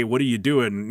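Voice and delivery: ordinary delivery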